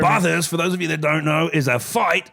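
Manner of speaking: loud Aussie bloke telling it; Aussie accent, hammed-up announcer. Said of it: "if I do an Aussie accent".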